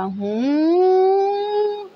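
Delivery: long rising siren wail; imitated siren. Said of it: "मेरे दिमाग में वो सायरन बजने लगा". (सायरन की नकल, लंबी चढ़ती आवाज़)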